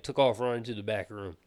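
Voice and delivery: ordinary delivery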